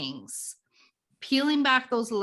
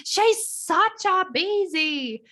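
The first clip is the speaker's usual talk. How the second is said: mock ranting; 7 semitones higher, much slower than usual. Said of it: "your conditioned brain going".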